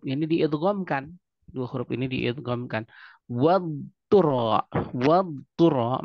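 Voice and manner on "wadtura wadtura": Wadtura very slowly, dhad drawn out; stretched dhad. Shown as a demonstration. Teacher modelling the stretched dhad for listeners. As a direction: pull the dhad long in Wadtura, then ta. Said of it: "diucapkan dulu istitolahnya, memanjang".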